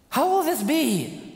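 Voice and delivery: high, wondering how will this be; wondering question. Acted. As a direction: ask it as an amazed Mary. Preacher voicing Mary's question.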